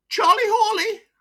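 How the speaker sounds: put-on high, broad Wearside voice of an old miner; chatty old pitman with a wheezy chest. Old miner to his doctor, broad Wearside.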